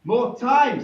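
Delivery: loud, high mock woman's cry; woman's retort. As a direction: call it out brightly to the king. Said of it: "says one woman".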